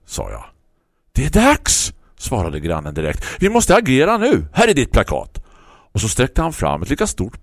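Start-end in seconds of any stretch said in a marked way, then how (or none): 1.1-5.4 eager neighbour. Acted. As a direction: announce it like a call to action. Neighbour announcing his plan.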